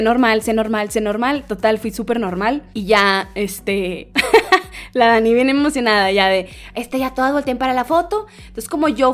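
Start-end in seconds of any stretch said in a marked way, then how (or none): 4.1-4.9 risa breve. Real, not acted. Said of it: "risa breve".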